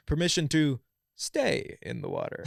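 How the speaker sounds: imitating an unbothered guy's polite request; super chill. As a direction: ask it like you're enjoying the ice. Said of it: "just super chill".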